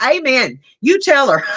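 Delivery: high, rousing cheer-along; emphatic amen. Acted. Viewer cheering on the host's rant, emphatic amen. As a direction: egg them on with a high-pitched amen. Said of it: "'cause I'll be like".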